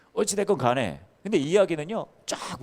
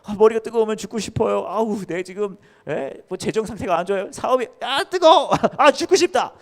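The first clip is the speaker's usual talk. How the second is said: high, loud whiny groaning with laughs; mock complaining churchgoer; 8 semitones higher, 6 dB louder than usual. Pastor imitating people who say they want to die, hammed-up whine. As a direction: ham up a fed-up believer.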